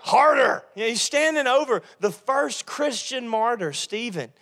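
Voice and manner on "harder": cruel order. Acted. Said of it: "He's like".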